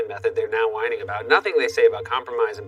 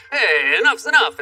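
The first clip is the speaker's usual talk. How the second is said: put-on slow, flustered old man shouting; an old neighbor finally losing patience; flustered yell; 6 semitones higher, 4 dB louder than usual. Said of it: "getting flustered and yells".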